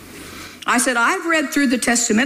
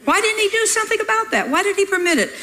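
puzzled thought; 9 semitones higher, faster than usual. Retold or imitated. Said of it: "Jesus knew what I was thinking".

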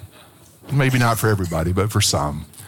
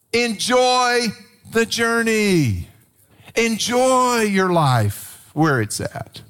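higher, slow and drawn out; emphatic; 8 semitones higher, much slower than usual. Real, not acted. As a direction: stretch out the message, slow and high. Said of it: "preacher hammering home the point".

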